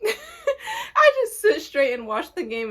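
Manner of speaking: breaking into laughter mid-line; laughing. Real, not acted. Repeats line while laughing.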